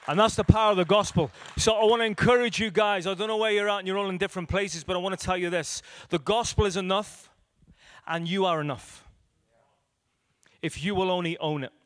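loud, driving preacher voice; rally the congregation, full volume; preaching at full force. Acted. Preacher urging the congregation that the gospel is enough, loudly.

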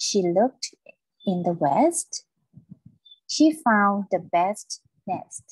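slow model reading, every word stressed up to "quest", "nest", "rest"; teacher's deliberate reading with crisp "st" in "best"; emphasis on each word. Shown as a demonstration. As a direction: pause between phrases, land clearly on "east", "west", "rest". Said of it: "เวลาอ่านอยากให้เน้นหน่อย".

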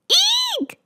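very high, squealed mock scream; high storybook scream. A narrator's reading. PJ scared by the movie, small high-pitched scream. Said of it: "screamed P J".

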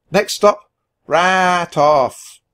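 loud, high conductor's announcement; sung-out train announcement; station call. A narrator's reading. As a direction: call out the stop, stretching the name. Train announcer calls out the stop.

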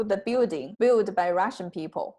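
ordinary talk, nothing marked

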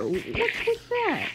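high, slow, squeaky complaint; a high, slow, puzzled whine; whiny squeak. Real, not acted. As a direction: squeak it out in disbelief. Fretting over repeat loot drops.

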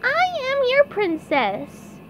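high, slow falsetto; SpongeBob as princess. A narrator's reading. SpongeBob fooling the crowd as princess, high falsetto. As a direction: declare it slowly in a high put-on voice. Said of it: "SpongeBob called to the crowd".

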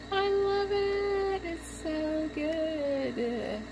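high, soft, drawn-out coo; slow, tiny adoring squeak; gushing delight. Real, not acted. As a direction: squeal softly and stretch every word. Gushing over new gadget.

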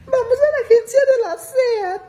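hammed-up weepy demand; mock tearful lady. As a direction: insist through mock tears like the señora. Lady tells driver where to go.

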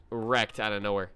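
ordinary talk

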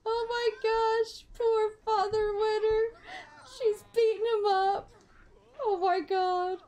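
high, breathless tearful laughter; wheezing with laughter, near tears; cry-laughing in disbelief; laughing so hard it sounds like crying. Real, not acted. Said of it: "It sounded like something terrible had happened".

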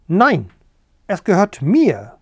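loud, agitated hen's protest; excited cackle. Acted. Hen Bertha squabbling over the egg, excited. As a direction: cackle it back in a flap. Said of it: "gackerte Bertha aufgeregt".

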